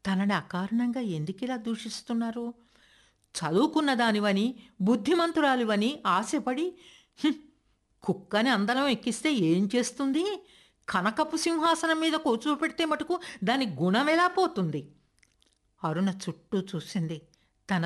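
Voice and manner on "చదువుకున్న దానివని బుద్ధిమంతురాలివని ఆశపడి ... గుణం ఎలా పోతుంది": put-on an old woman tearing into her granddaughter; scolding grandmother. A narrator's reading. Grandmother accusing Aruna, high and angry. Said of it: "అమ్మమ్మ గారి కంఠం తారస్థాయిని అందుకుంది".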